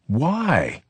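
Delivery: sudden high cry of why; cried out. A narrator's reading. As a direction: cry it out in alarm. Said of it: "cried Vivaldo".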